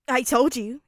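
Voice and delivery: loud, triumphant crow. A narrator's reading. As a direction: announce it loudly like you've won. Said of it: "Kirishima said triumphantly".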